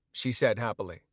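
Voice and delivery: plain reading voice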